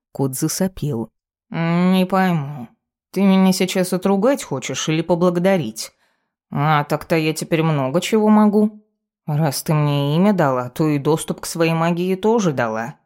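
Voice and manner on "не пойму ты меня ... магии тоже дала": drawling, self-satisfied talking cat; smug cat familiar. Acted. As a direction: play a cheeky, preening cat. Cat boasts of new powers.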